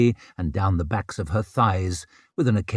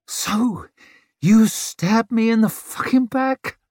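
high, whining hurt voice; wounded whine; 13 semitones higher, 4 dB louder, slower than usual. Acted. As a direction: whine like a betrayed child. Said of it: "a wounded whine to it".

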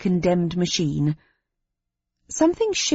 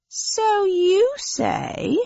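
much higher, slow skeptical alien; 13 semitones higher, much slower than usual. Acted. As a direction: drawl it out doubtfully, high. Small alien doubting.

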